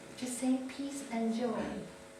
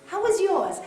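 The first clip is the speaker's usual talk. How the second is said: mock demanding partner; 6 semitones higher, 8 dB louder than usual. Repeating the demand.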